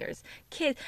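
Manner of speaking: whispered aside. Real, not acted. Podcaster whispering to listeners about kids in park. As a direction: hush it to a whisper. Repeats line, first whispered.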